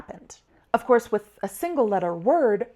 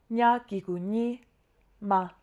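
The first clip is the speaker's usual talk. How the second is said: "Nya, gigu, ni", pause, then "ma"; syllables put separately. Shown as a demonstration. Teacher spelling a two-syllable word, syllables kept separate. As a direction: recite "Nya, gigu, ni" as one group, "ma" apart. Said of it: "I put them separately".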